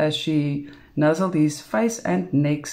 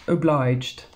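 usual speaking voice

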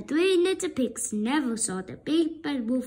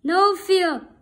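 louder, firm refusal; pig refusing; 4 semitones higher, 6 dB louder than usual. Acted. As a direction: refuse the wolf boldly.

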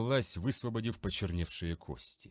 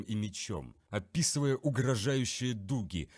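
ordinary delivery, nothing marked

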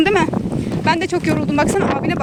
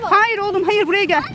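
ordinary talk

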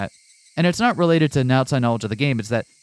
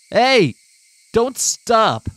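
sharp shout; 11 semitones higher, 5 dB louder, much slower than usual. Acted. Keiichi yelling at Rena.

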